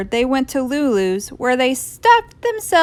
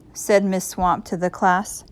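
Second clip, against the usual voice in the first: ordinary delivery, nothing marked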